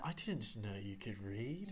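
slow, disdainful British movie line; disgusted British accent. Pretend. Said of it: "more disgusted than that".

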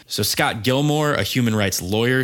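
normal talking voice

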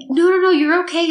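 imitated husband snapping into comfort; urgent reassurance. Husband snapping out of shock to comfort his wife, urgently reassuring. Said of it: "snaps out of it".

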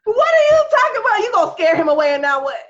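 very high, loud comic outrage; shrieking outrage. Retold or imitated. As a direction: shriek it, laughing and indignant. Outraged retort to father.

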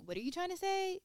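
high mocking voice asking What are you trying to say?; puzzled high pitch; mocking questioner. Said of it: "when someone mock".

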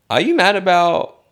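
high, loud squeal of disbelief; mock outrage. Vlogger mimicking his row with friends, a high loud squeal.

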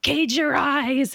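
slow, clipped-free mock sergeant command; mock drill sergeant, drawn out; drill sergeant order. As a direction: bark it like a drill instructor, slowly. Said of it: "And they're like".

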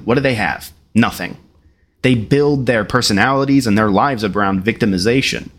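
usual speaking voice

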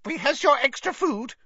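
high, quick elf squeak; an elf hustling guests out; brisk elf squeak. A narrator's reading. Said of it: "squeaked an elf".

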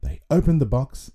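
ordinary delivery

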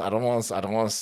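ordinary talk, nothing marked

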